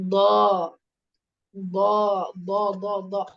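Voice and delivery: slow demonstration of the letter ض, heavy and full-mouthed; teacher drilling ض for the class; teaching dad. Shown as a demonstration. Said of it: "Now third heavy letter".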